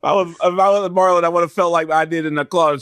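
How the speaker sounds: normal talking voice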